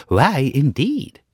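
high, slow, airy butterfly; a floaty little butterfly wondering. Acted. Butterfly answers.

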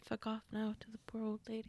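soft sheepish whisper. Real, not acted. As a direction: whisper it, a bit embarrassed. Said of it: "I don't know why I said".

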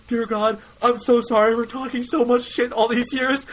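high, strained plea to God; high-pitched mock-desperate confession; desperate prayer. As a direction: pray like you're begging for your life. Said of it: "I literally started praying".

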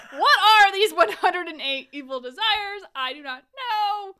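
high, loud theatrical demand; mock outraged bewilderment. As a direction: blow up in hammed-up disbelief, slowing at the end. Mock outburst at evil desires.

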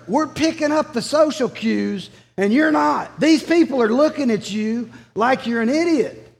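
mildly hammed-up disbelief; exasperated disciples. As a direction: play the embarrassed friends begging him to tone it down. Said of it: "And they're like".

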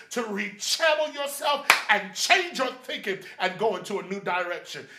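high, driving pulpit crescendo; full-force preaching. Acted. Preacher urging a detour from anxiety, full-force preaching.

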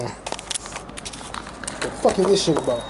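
slow, low muttering; muttered. Real, not acted. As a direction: mutter it under your breath. Muttering at birds.

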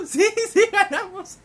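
high, loud, laughing yes; waitress laughing as she hears they won; laughing with joy. Acted. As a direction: laugh it out in disbelief.

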